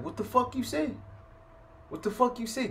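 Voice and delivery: high, heated mock challenge; angry challenge. Youngster on the phone challenging the narrator, heated and high. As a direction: fire it back like a hothead picking a fight.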